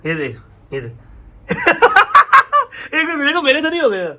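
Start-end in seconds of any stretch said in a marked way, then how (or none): 1.5-2.9 shrill burst of laughter at own failed try; high squealing laugh. Real, not acted. Tries touching nose with tongue.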